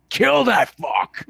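high, drawn-out screamed cheer; excited yell. Retold or imitated. Moviegoer re-enacts cheering Batman beating Superman, excited yell.

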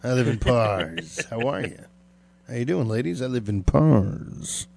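hammed-up ladies' man, lingering on the name; sexy pick-up line. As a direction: draw out the words like introducing yourself to the ladies. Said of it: "Sounds sexy".

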